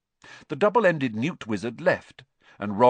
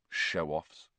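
low, softer muttered aside; 5 semitones lower, 7 dB quieter than usual. Acted. Ron mutters at the rats, low and soft. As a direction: grumble it under your breath. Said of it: "Ron muttered".

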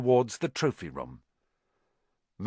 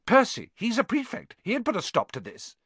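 put-on high-pitched indignant girl; snapping; 10 semitones higher than usual. A narrator's reading. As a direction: scold them in a high voice. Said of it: "Hermione snapped".

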